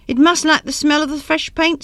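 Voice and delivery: high, despairing voice; despairingly. A narrator's reading. Wife despairs to husband over the ivy, high and hopeless. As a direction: lift the pitch in dismay. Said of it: "said Judy despairingly".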